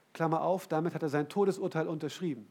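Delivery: normal talking voice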